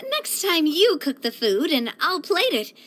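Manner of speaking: usual speaking voice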